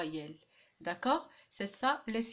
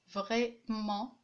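"Vraiment" divided as vrai, then ment; a small break inside "Vraiment"; two separate syllables. Shown as a demonstration. Teacher comparing two-syllable words, split in syllables. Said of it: "Il y a deux syllabes aussi".

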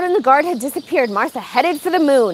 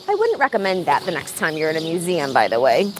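much lower, softer, faster aside; wry aside; 9 semitones lower, 4 dB quieter, faster than usual. Real, not acted. Reader turns to warn listeners, lower quick aside.

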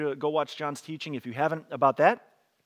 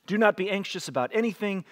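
scripture reading; 4 semitones higher than usual. Acted.

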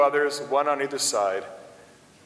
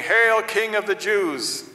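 higher, drawn-out hail; acclaiming hail; 8 semitones higher, 4 dB louder, slower than usual. A narrator's reading. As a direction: proclaim it slowly, pitched well up. Soldiers hailing Jesus in mockery.

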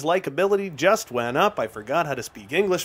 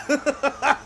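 high, breathless burst of laughter; giddy laugh; 11 semitones higher than usual. Real, not acted. Gamer laughing after a goal, high and giddy. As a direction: laugh it out in pure glee.

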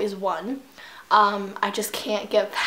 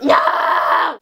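loud, raised cry of defeat; dismayed shout; 5 semitones higher, 6 dB louder than usual. Real, not acted. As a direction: shout out the loss. Losing in game.

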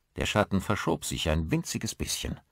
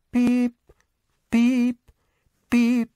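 high, loud clipped beeps; alarm beeps; 8 dB louder, much slower than usual. A narrator's reading. Narrator imitates the alarm clock ending the dream, high beeps.

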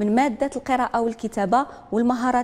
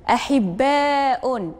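very slow repeat; أحباء said slowly with the long vowel held; drawn-out model word; much slower than usual. Shown as a demonstration. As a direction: stretch أحباء again syllable by syllable. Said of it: "واش هاد الكلمة تتضمن حرف المد".

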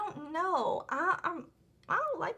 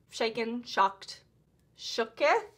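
ordinary talk, nothing marked

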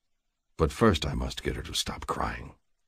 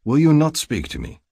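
higher, louder plea; urgent, raised entreaty; entreating; 10 semitones higher, 6 dB louder than usual. A narrator's reading. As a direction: plead to be answered. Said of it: "I entreat again".

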